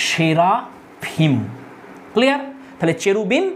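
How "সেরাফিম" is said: সেরাফিম very slow, SHERA... PHIM; syllable-by-syllable demo. Shown as a demonstration. Teacher showing the Hebrew plural, সেরাফিম slowly split. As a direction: stretch সেরাফিম and pause before the last syllable. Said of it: "তাহলে এটা হয়ে যাবে".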